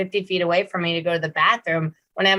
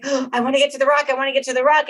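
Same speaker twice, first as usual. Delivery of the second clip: higher, fast, lightly voiced eager puppy; a hint of a pup straining to get there; 6 semitones higher, faster than usual. Trainer voices the puppy rushing to the rock, eager. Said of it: "he's like".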